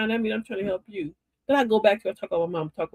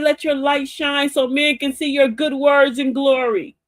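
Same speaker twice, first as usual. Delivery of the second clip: slow, louder sermon voice; preacherly elder; 6 semitones higher, 5 dB louder, slower than usual. Retold or imitated. Queen Mother blessing the show, slow and loud like a preacher. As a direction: proclaim it slowly like a church mother. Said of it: "She said".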